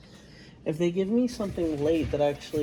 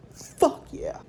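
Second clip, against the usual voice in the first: excited. Real, not acted. Excited about mashed potatoes.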